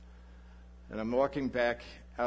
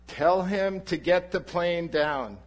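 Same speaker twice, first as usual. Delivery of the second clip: slow, louder, higher demand; impatient command; 6 semitones higher, 7 dB louder, slower than usual. Retold or imitated. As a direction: hammer out the order, losing patience. Demanding the plane land.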